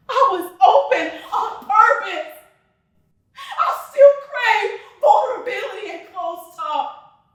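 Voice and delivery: high, loud, wounded insistence; impassioned declaration. Acted. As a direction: cry it out with full force. Impassioned declaration to former lover.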